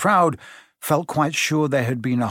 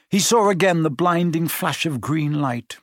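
plain reading voice

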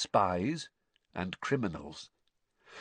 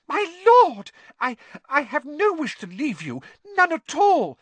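very high, loud, stammering squeal; alarmed denial; 10 dB louder than usual. A narrator's reading. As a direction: protest frantically to your master. Servant frantically denies wanting to desert.

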